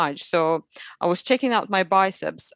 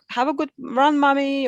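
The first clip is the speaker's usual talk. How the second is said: put-on child voice; 7 semitones higher than usual. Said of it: "they was like".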